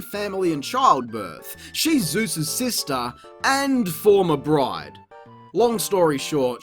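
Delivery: usual speaking voice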